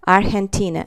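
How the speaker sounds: Argentina as ar-hen-tee-na with a breathed H. Shown as a demonstration. Teacher repeating the example for students, G as H. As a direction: say Argentina with huh in place of G. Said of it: "or h".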